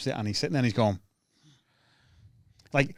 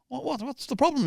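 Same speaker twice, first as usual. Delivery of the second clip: high, sing-song mock concern; comic needling a heckler in a high voice; mock polite comic; 12 semitones higher, 4 dB quieter than usual. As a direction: press him with fake politeness. Comic pressing a silent punter.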